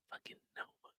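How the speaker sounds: a muttered, breathless curse under the breath; whispered grumble. Retold or imitated. Coworkers cursing the boss behind her back, whispered. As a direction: whisper it like she might overhear.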